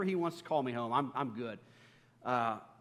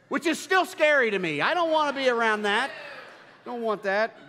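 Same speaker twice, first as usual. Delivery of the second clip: laughing, higher and louder; giggling, louder and higher, mock-scared; 8 semitones higher, 9 dB louder than usual. Real, not acted. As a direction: laugh through a mock fear of manatees. Laughing about the manatee scare.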